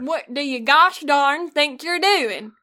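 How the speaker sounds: slow, loud Southern drawl; hammed-up Southern exasperation; scolding Southern. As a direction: scold in a put-on Southern accent. Mock Southern scolding exchange.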